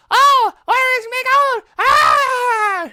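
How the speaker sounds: ear-splitting high shriek of a leprechaun imitation; super high-pitched, annoying leprechaun. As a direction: screech it slowly at the very top. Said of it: "super high-pitched and annoying".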